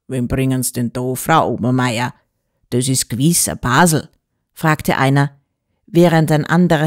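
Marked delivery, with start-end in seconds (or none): 0.1-4.1 lightly voiced lower-pitched tavern regular; low-voiced dialect questioner, mildly nosy; curious gentleman. A narrator's reading. Gentleman asking the landlady about the newcomer, low and curious.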